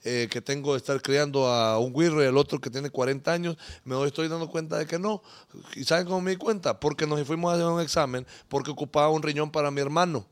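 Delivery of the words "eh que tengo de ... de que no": low, soft voice of a dejected father; imitating tearful man. Father telling viewers about the man he raised, mock tearful. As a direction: retell his sad confession, subdued. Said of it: "con lágrimas en los ojos diciendo".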